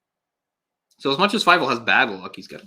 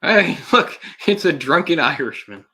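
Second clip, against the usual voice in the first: amused; 6 semitones higher, 6 dB louder than usual. Real, not acted. Laughing at movie stereotype.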